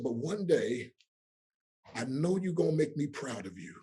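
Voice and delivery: usual speaking voice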